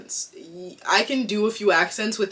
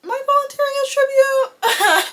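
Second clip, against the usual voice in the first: much higher, hammed-up heroic cry; mock heroic; 6 dB louder, much faster than usual. Joking about volunteering for her sister, in a hammed-up heroic voice. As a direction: throw the famous line out like a movie heroine. Jokes about the Hunger Games.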